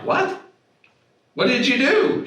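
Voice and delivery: high, loud disbelieving what did you do; retold shock. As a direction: recreate your stunned reaction to her. Shocked at emptied energy bottles.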